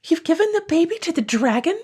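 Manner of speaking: high shocked cry of a wife. Acted. Wife crying out in horror at her husband. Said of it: "she cried".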